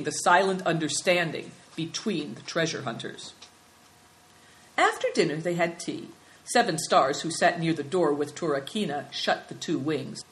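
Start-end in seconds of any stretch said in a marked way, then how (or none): none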